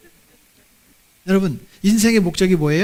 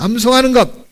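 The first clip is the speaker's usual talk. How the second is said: raised, emphatic slogan for the crowd; preacher's call-out, pitched up; call for repetition; 4 semitones higher, 4 dB louder than usual. Real, not acted. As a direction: lead the congregation in repeating it. Leading congregation to repeat slogan.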